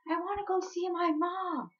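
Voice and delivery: high-pitched imitated wail; crying plea. Crying niece struggling with aunt, high pleading wail. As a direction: act out a tearful whine for mom. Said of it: "I started crying more".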